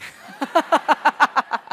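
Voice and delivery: high burst of laughter; loud laugh. Real, not acted. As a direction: laugh out loud at own joke. Laughs after joke.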